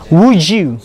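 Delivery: "Would you" run together as "wood-joo"; "Would you" with a J joining the two words; linked d-y sound. Shown as a demonstration. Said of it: "it becomes J".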